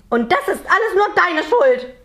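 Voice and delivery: high, loud scolding little-man voice; angry accusation. A narrator's reading. Little man blames Jonathan for everything, angry accusation.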